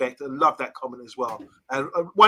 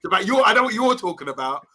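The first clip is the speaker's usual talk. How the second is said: real laughter through the words, higher; laughing; 8 semitones higher than usual. Real, not acted. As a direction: talk while cracking up. Laughing at co-host's word blowhole.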